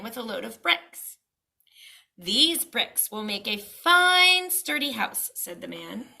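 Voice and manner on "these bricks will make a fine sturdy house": higher, louder, slow sales pitch; proud seller. Acted. Brick seller praising his bricks, slow and proud. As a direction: sell the bricks with pride.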